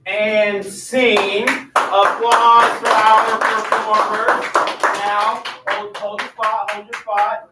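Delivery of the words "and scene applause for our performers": loud, drawn-out emcee call; announcer calling applause. Real, not acted. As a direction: project slowly to the whole crowd. Director ends scene, calls for applause.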